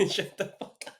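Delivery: laughed-through shut up; laughing dismissal. Real, not acted. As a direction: brush him off while cracking up. Laughing at the joke.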